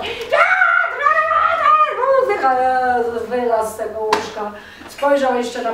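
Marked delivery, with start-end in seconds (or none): 0.0-2.5 high, slow frantic shout; panicked wife yelling. Acted. Wife wakes her husband shouting the ox is dying. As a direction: shriek the alarm like a panicked farm wife.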